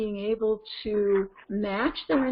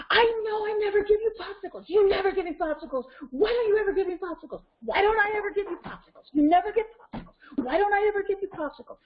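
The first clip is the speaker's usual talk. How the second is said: put-on high, fast, worked-up wail mirroring a child; matched intensity; 10 semitones higher, faster than usual. Shown as a demonstration. Parent reflecting child's popsicle rage, matching the intensity. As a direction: echo the tantrum at full pitch. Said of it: "So I'm reflecting, but I'm not reflecting in this".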